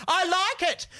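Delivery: high, loud over her headphones; speaking really loudly. Retold or imitated. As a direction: talk over music blasting in her ears. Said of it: "she was speaking really loudly".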